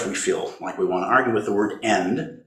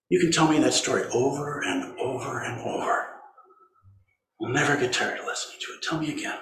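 softer, whispered comfort; higher whispered comfort to one's sorrow; 4 semitones higher, 6 dB quieter than usual. Acted. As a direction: whisper it gently, like to a child.

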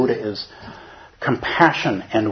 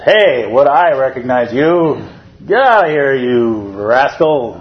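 raised, slow put-on doorkeeper voice scolding; Ananda shooing Mara; 3 semitones higher, 6 dB louder, slower than usual. Ananda at the door chasing Mara away, loud and scolding. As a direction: drive the rascal off the doorstep. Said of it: "Ananda meets him ... and says".

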